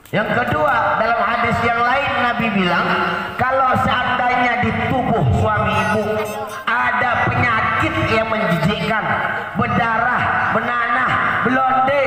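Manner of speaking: high, emphatic sermon delivery. Real, not acted. Preacher recounting a second hadith to wives, high emphatic preaching. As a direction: press each item home, pitched high.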